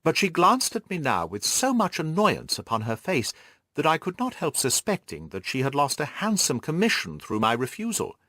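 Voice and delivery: plain reading voice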